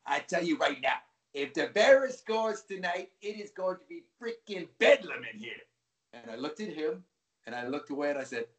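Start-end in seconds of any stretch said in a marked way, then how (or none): 0.0-5.5 raised, emphatic New York fan impression; New York accent. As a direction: imitate a New Yorker making a bold prediction. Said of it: "in his New York accent".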